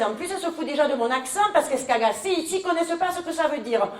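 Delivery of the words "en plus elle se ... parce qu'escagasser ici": ordinary talk, nothing marked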